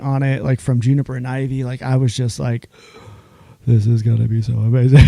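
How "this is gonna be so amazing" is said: loud, gushing delight; excited gush. Retold or imitated. Guest retelling a birthday dinner, loudly thrilled. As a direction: gush over the surprise menus.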